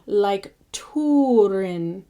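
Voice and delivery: slow Turin, TOO-rin. Shown as a demonstration. Teacher modelling Turin slowly with an oo. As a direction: Turin drawn out with oo. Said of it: "pronounced oo".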